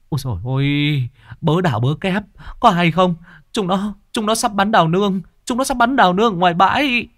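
panicked shouting. A narrator's reading. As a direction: cry the alarm to the theatre troupe. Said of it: "Tiếng kêu vang vọng".

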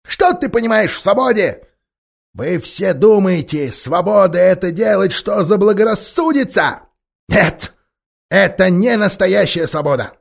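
high, bossy ape voice; helium voice. Acted. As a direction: lecture them like a petty tyrant. The ape lectures the beasts.

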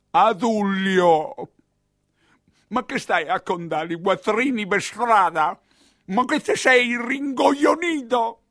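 slow, loud and then high, telling off a fool; an old hag yelling at her accomplice in the street; drawn-out exasperated scolding; scolding old woman. Acted.

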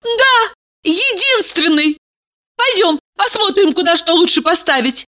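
put-on high eager girly voice; bubbly sorority girl. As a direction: gush along brightly. Valeria voiced gushing.

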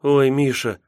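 lightly acted, falling cry of his name; heroine's exclamation. Yulka exclaims to Misha, lightly acted.

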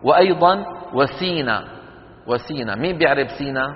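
ordinary delivery

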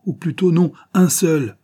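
cri emporté, aigu et fort. A narrator's reading. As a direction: crier avec emportement. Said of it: "répondit l'inconnu avec emportement". (cri emporté, aigu et fort)

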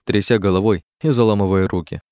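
usual speaking voice

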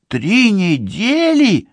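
put-on high, indignant girl's question; incredulous young woman, pitched high. A narrator's reading. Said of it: "негодует Ливи".

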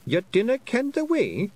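high, astonished Scottish question; incredulous. A narrator's reading. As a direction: sound amazed she doesn't know. Feegle is startled by her question.

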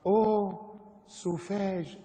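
high, affected exclamation; mock French horror. Preacher reads French caption on bitten soldier, mock horror.

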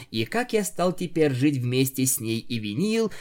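plain reading voice

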